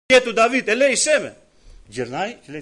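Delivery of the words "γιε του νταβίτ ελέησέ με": higher and louder repeated cry; calling again. Retold or imitated. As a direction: cry out once more, more desperate. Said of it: "Μετά ξανά". (ο τυφλός ξαναφωνάζει, πιο δυνατά και ψηλά)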